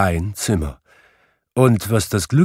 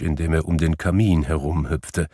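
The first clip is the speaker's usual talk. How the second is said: ordinary delivery, nothing marked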